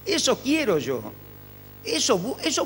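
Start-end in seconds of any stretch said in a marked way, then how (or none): none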